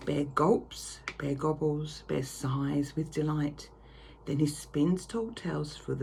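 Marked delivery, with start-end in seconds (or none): none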